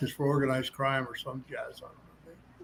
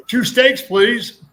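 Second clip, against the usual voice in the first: joking Ali; 8 semitones higher, 9 dB louder, much slower than usual. Retold or imitated. Ali joking order, high loud.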